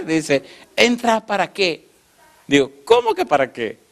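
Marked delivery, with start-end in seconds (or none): 0.8-1.8 high, slow incredulous question; retold challenge. Woman questions the man wanting to enter, incredulous challenge. 2.8-3.8 high-pitched mock indignation; indignant comeback. Man in line answers the woman, indignant comeback.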